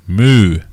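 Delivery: a loud, clear cow moo. Shown as a demonstration. Streamer mooing loudly to teach a word. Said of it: "what noise does a cow make".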